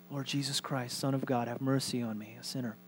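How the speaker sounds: very soft, low murmured prayer; hushed prayer. Shown as a demonstration. Pastor repeats the short prayer for congregation, hushed and meditative. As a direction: pray it under your breath.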